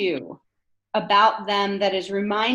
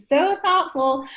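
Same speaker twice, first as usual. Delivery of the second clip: mock gushing; 6 semitones higher, slower than usual. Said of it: "every situation".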